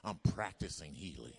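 a preacher declaring healing over himself; preaching confession. Acted. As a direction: declare each line like a creed.

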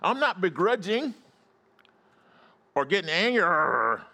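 high, emphatic sermon delivery; fired-up preaching. Real, not acted. Pastor urging congregation to be glad about church, fired-up preaching. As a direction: preach it high with stretched key words. Preacher rises to full force.

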